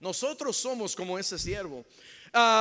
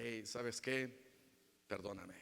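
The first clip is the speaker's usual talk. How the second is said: much softer, humble plea for forgiveness; soft apology; 8 semitones lower, 12 dB quieter than usual. Retold or imitated. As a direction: say it gently, humbly. Asking spouse forgiveness softly. (disculpa muy suave)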